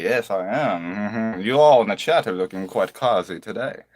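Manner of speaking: ordinary talk, nothing marked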